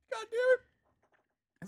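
high, slow, laughing and choking; losing breath from laughing; choking on laughter. Real, not acted. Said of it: "I'm choking".

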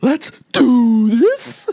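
high, slow hyped-up shout; wrestling promo. Acted. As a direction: bellow it like a wrestler entering the ring. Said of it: "Let me get into character".

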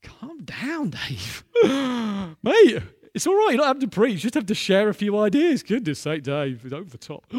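half-joking plea to tone it down; flustered, comic inner pleading; mock alarm. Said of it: "I was thinking".

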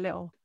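"little" with a dark, vowel-like ending; "little" in the speaker's loose Essex way; little said improperly. Shown as a demonstration. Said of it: "I'd always be like".

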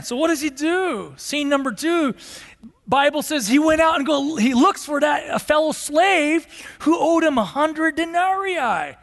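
normal talking voice

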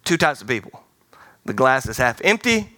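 ordinary talk

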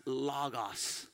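"logos" said as LAH-gahs; "logos" pronounced as a Greek word; Greek pronunciation. Shown as a demonstration. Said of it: "in Greek is pronounced".

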